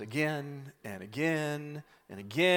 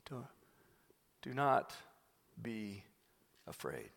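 calm reassurance; 5 semitones lower, 14 dB quieter than usual. Retold or imitated. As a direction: soothe him gently, barely above a hush. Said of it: "smiles and calmly says".

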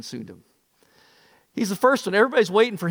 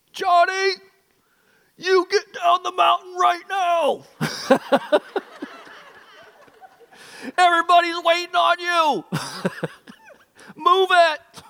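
high, loud commanding shout; a father yelling up the mountain at his son; dad shouting; 13 semitones higher, 5 dB louder than usual. Retold or imitated. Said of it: "he shouts out".

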